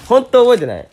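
high laughing denial. Real, not acted. アーモンド効果の箱に驚き笑う. (笑いながら高い声で否定)

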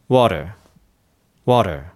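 American "water" with a lightly tapped T; "water" with T like a Japanese R; lazy flapped T. Shown as a demonstration. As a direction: flick the tongue casually for the T in "water". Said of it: "それが結構音として近いんですよ".

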